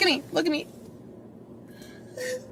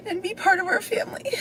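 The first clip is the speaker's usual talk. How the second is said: breaking into a high whisper; tearful whisper; whispered sob; 10 semitones higher than usual. Real, not acted. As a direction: let the voice crack into a whisper. Mother breaking down on camera.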